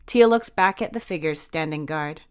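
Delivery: plain reading voice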